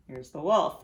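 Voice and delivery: plain reading voice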